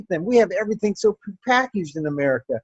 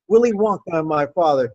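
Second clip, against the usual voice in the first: ordinary talk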